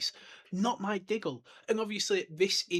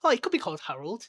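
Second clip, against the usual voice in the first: higher, louder, quick mocking echo; mocking denial; 5 semitones higher, 6 dB louder, faster than usual. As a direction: parrot the excuse back, scoffing. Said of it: "but it was like".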